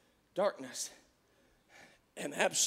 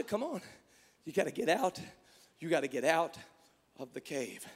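gentle voice of God. Acted. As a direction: call him out of the cave gently. God speaks softly to Elijah.

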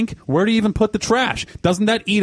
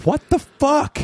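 incredulous; 9 semitones higher, much slower than usual. Real, not acted. Disbelief at the bathroom claim.

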